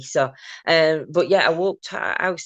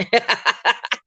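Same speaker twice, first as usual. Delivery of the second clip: short laugh; 7 semitones higher, 3 dB louder than usual. Real, not acted. Laughs at her own joke.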